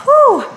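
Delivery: high whoop of encouragement; excited woo. Real, not acted. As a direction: cheer the class on. Instructor cheering mid-workout.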